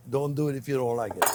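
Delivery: repeated softly through a laugh. Real, not acted. Chef teasing viewers about garlic, laughing softly. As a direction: chuckle as you say it again.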